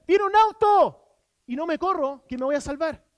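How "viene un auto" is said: loud, high, slow warning yell; shout. Retold or imitated. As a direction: yell the warning at full force. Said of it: "por yo gritar". (grito fuerte y agudo de alarma)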